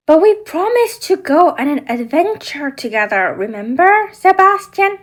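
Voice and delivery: Ariel pleading. Acted. Ariel doll reminding Sebastian of their adventure, pleading. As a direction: remind the crab of his promise.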